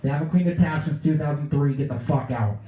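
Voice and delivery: loud angry yell; TV chef yelling. Retold or imitated. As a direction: bark it like a furious show host. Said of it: "heard his voice".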